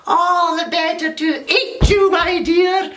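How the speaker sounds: put-on wolf revealing himself to the girl; wolf pouncing. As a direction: hiss it gleefully then bite. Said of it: "And the wolf says".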